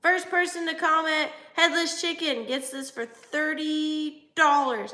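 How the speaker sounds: high, projected auctioneer call, stretching the price; hammed-up live-sale pitch; mock sale announcer. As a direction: playfully hawk it to the crowd. Jokingly plays a live-sale seller.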